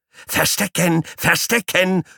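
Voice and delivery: very high squealing shout; squeaky guinea pig yell. Acted. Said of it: "brüllt Paule in mein rechtes Ohr".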